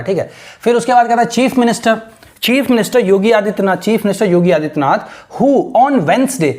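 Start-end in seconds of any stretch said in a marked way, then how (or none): 5.4-6.5 careful reading. Shown as a demonstration. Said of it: "अब इसको Wednesday नहीं पढ़ते हैं".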